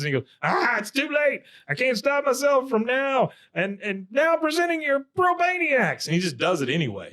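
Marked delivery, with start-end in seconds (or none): 0.4-6.1 very high, frantic retold outburst; hammed-up helpless announcement; mock panic. Keyboard player who can't stop the music, mock-panicked.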